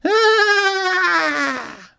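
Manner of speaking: long high wail; falling cry. A narrator's reading. Trucks crying out as they tumble down, high long wail. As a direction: scream as you tumble. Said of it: "they cried".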